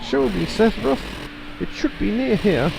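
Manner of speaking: high-pitched mad villain voice; villain summoning Sephiroth. Acted. Villain calls on Sephiroth, high theatrical voice.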